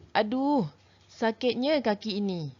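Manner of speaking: a lightly acted ouch over a sore foot; mock pain cry. Shown as a demonstration. Teacher demonstrating an exclamation, with a mild mock cry of pain. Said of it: "melahirkan pelbagai perasaan".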